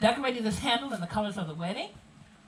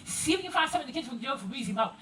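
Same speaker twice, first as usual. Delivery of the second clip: pitched-up, running Gullah chatter to Granny; Gullah dialect. Acted. Grandchild warning Granny about Miss Jill, Gullah dialect. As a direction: tell Granny about the guest in thick Gullah. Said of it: "this is how the conversation would go".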